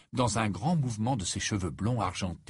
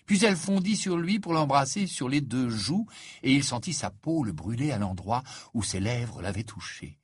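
ordinary delivery

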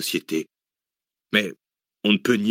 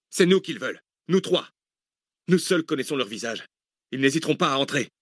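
urgent warning; 6 semitones higher than usual. A narrator's reading. Pressing warning during the attack.

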